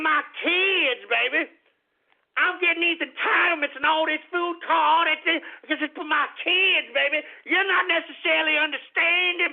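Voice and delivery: high, whining caricature voice; exaggerated pleading parent impression; mock welfare claimant. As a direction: plead in a hammed-up, high-pitched mocking voice. Mocks people claiming entitlements.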